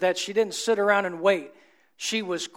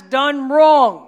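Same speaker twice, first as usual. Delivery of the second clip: emphatic preaching; 4 semitones higher, 9 dB louder than usual. Real, not acted.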